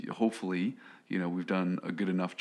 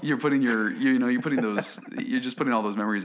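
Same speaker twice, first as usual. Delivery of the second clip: raised, louder insistence; emphatic; 5 semitones higher, 6 dB louder than usual. Real, not acted. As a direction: press the point home, higher and louder. Emphatic point about lost memories.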